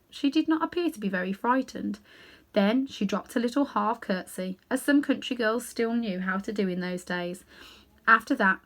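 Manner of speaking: ordinary delivery, nothing marked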